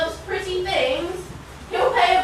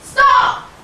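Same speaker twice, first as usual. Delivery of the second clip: sharp, loud, higher bark of stop; angry shout; 6 semitones higher, 6 dB louder than usual. Acted. Wife cuts her husband off with a furious shout. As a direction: yell him to a halt at once.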